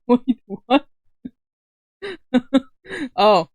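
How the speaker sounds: ordinary talk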